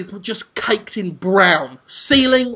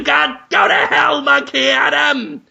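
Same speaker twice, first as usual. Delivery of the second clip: fist-shaking shout; 6 semitones higher than usual. Acted. Willem Dafoe yells out the window at Monkey Adam, hammed-up fury. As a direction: curse him out the window. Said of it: "while he's going".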